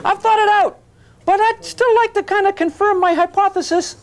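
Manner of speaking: hammed-up please-please begging; mock begging student. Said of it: "how many say".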